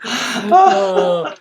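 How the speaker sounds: high, loud wail at the end of laughter; helpless laughing sigh. Real, not acted. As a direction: catch breath after a laughing fit. Recovering from laughing fit.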